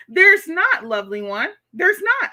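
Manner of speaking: high, loud insistent agreement; emphatic agreement. Real, not acted. Agreeing loudly with Lovely One in chat, high and emphatic. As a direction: slam the point home to the commenter.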